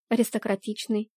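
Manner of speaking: plain reading voice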